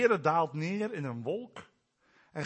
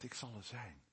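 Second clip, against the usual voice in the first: God's quiet promise; 7 semitones lower, 17 dB quieter than usual. Acted. Quoting God's name meaning.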